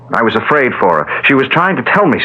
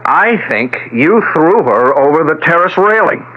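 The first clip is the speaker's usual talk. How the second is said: accusing; 6 semitones higher than usual. Acted. Son accuses stepfather of murder.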